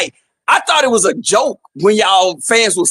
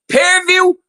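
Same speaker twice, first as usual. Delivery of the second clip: calling out; 8 semitones higher than usual. Real, not acted. Calling out to the team.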